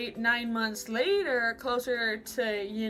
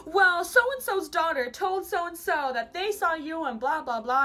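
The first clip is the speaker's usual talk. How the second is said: higher, louder, drawn-out gossip relay; exaggerated impression of an insistent mother; mock accusing mom; 6 semitones higher, 5 dB louder than usual. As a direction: imitate a mother pressing her case. Mimics mom passing on gossip.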